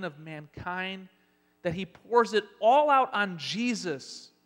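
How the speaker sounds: slow, loud emphatic preaching; preaching at full force. Acted. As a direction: drive home the weight of the wrath.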